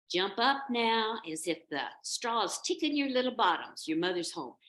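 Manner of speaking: rousing motherly announcement; mother calling out. Acted. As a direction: call the kids up from their beds. Mother goat calls to kids.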